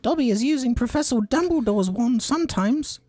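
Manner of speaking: very high squeaky house elf voice; squeaky Dobby voice. Acted. Reading the house elf's line to friends, squeaky high. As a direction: pitch way up into a squeaky elf falsetto. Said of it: "he acknowledged guiltily".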